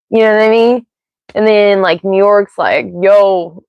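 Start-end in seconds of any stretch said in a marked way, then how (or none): 3.0-3.6 badly faked New York accent; a hint of mock New Yorker. Streamer joking about regional accents, bad New York accent. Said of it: "that was such a bad impression".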